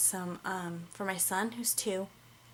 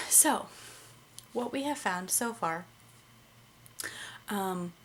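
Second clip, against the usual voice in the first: ordinary delivery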